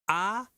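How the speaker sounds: "A" as a clear, high open oral vowel; demonstrated A sound. Shown as a demonstration. Teacher showing the sounds to distinguish, "A" as an oral vowel. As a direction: say "A" alone, cleanly and without nasality. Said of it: "vous allez distinguer le".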